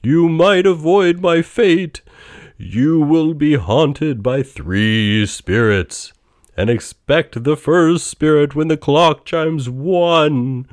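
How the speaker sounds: loud, high, slow spectral voice; booming ghost. A narrator's reading. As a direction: boom it out like a phantom. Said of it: "said the ghost".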